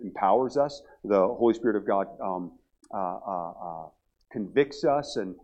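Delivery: ordinary talk, nothing marked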